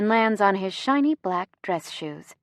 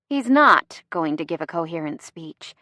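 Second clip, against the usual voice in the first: ordinary delivery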